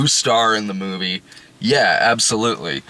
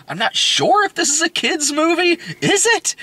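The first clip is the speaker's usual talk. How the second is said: confused disbelief. Retold or imitated. Retold own confused reaction.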